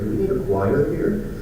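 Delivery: ordinary talk, nothing marked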